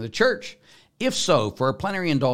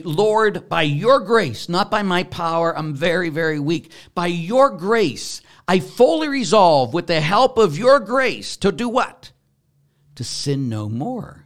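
raised, earnest pleading to the Lord; fervent prayer; 8 semitones higher, 8 dB louder, slower than usual. Shown as a demonstration. Priest voicing a penitent's prayer to God, loud and fervent. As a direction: pray it out loud with full conviction.

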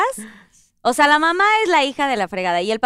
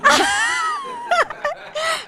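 laughing. Real, not acted. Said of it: "¿Por qué se ríen".

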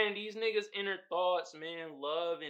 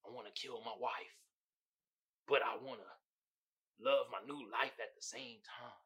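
much softer and lower, brooding; soft inner monologue; 5 semitones lower, 9 dB quieter than usual. Retold or imitated. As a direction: murmur it like secret thoughts. Voicing Joe's inner thoughts.

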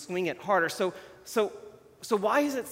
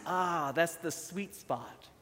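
contented sigh; 6 dB quieter, slower than usual. Pretend. Describing the relaxed sweet life.

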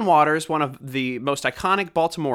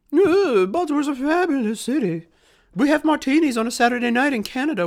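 high, prissy mock old-lady voice, much higher; old Canadian ladies; 10 semitones higher than usual. Mocking old Canadian women tweeting over martinis, high and prissy. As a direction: lampoon tipsy old ladies sipping martinis. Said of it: "three old Canadian women drinking martinis saying".

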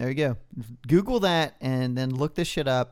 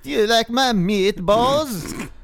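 fake German accent; 7 semitones higher, 5 dB louder, slower than usual. Playing Jürgen character.

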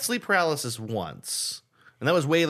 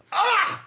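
very high, short scream; re-enacted startled yelp, pitched way up; retold scream; 3 dB louder than usual. Driver sees the car behind coming, re-enacted high scream. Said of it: "I think I just went like".

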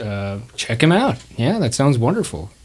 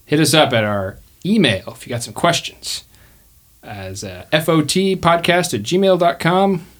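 ordinary talk, nothing marked

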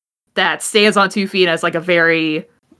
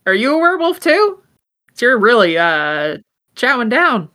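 Virgil's voice; 5 semitones higher than usual. Acted. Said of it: "Virgil's like".

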